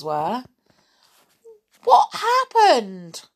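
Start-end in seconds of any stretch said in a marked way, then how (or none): 1.8-3.3 very high, loud, drawn-out question; shocked storybook exclamation; astonished cry. A narrator's reading. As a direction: cry out in amazement. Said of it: "cried Rick".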